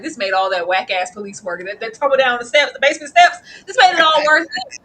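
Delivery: loud, laughing, near tears; crying out that it was all worth it; emotional outburst. Retold or imitated. Podcaster reliving the episode, loud and teary. Acted inner reaction to episode.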